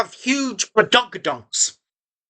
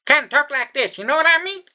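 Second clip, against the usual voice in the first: ordinary talk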